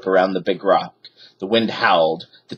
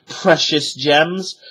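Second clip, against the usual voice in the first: plain reading voice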